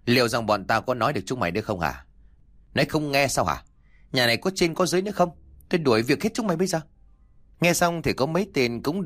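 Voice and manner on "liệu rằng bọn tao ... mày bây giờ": scolding yell. Acted. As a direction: rattle off threats at the servants. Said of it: "bị mấy mụ vợ quát tháo một trận".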